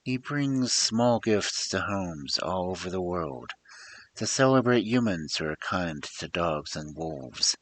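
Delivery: usual speaking voice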